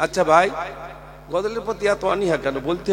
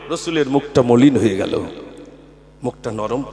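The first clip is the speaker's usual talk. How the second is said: usual speaking voice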